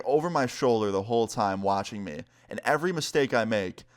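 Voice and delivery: ordinary talk, nothing marked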